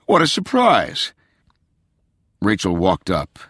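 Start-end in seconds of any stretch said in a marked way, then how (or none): 0.0-1.1 high-pitched show of happy surprise; staged delight for a daughter; smiling, feigned delight. A narrator's reading. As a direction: gush it with a phony smile. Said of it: "smiling and opening his arms".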